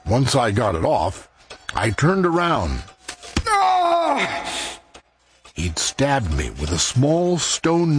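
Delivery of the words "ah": high cry of pain. Acted. Man stabbed over the heart, pained cry. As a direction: cry out at a sudden stab.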